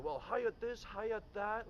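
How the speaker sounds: high, mocking sing-song of the name Hyatt; mocking boss. Boss mocking the new valet's pronunciation of Hyatt, high and scornful. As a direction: scoff at the name like an irritated boss. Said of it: "then he was like".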